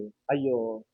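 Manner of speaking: low mock "oh no" of pity; feigned sympathy. False friend commiserating over a neighbour's misfortune, in mock pity. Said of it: "పైకి తెగ ఏడుస్తారు".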